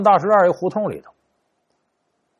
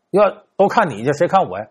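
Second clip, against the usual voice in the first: normal talking voice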